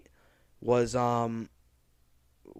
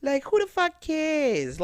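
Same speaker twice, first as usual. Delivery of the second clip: mock careless shrug; 12 semitones higher, slower than usual. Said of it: "mocking past selves".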